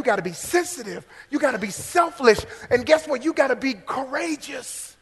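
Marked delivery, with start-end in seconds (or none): none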